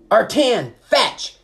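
high, loud command to fetch; calling out. A narrator's reading. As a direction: call it out like an urgent order to an animal. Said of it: "he called".

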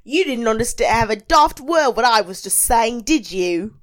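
hammed-up Australian accent. Host to baffled girls, imitated Australian accent. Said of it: "when I do an Australian accent".

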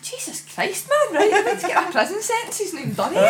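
high, fast, disbelieving outburst; exasperated. Retold or imitated. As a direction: blurt it out in disbelief. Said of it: "I was like".

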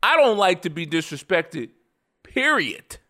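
"period" set apart and pitched high, falling, with a crisp T; emphatic final word. Shown as a demonstration. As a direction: end hard on "period", high, with a T sound. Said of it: "with a T on the end".

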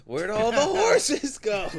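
high, slow and loud through laughter; laughing. Real, not acted. Joking with cohost about stolen horses, laughing hard. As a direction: squeeze it out while cracking up. Joke lands, cracking up.

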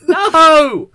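loud, high, laughing cry of shock. Real, not acted. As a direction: yelp it in laughing disbelief. Reacting to headline.

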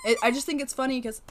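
ordinary delivery

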